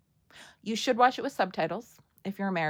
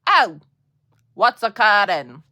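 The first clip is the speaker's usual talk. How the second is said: booming out a quote from the show; loud quoted catchphrase; 13 dB louder than usual. Acted. Fan acting out a show line, very loud. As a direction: call it out far louder than usual.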